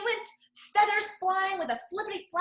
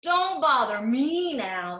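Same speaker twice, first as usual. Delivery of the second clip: slow annoyed brush-off; 3 semitones lower, 7 dB louder, slower than usual. Acted. Duck brushes off hen.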